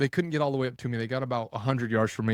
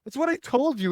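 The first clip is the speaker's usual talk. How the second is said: exasperated. Retold or imitated. Exasperated quote continues.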